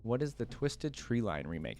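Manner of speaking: ordinary delivery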